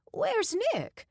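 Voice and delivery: put-on a doting old lady asking after Nick. A narrator's reading. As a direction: ask like a curious grandma. Doreen asks in her voice.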